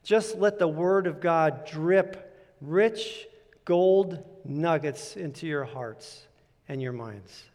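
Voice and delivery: drawn out phrase by phrase, fading; slow, savoring. Shown as a demonstration. Illustrating soaking in the Word.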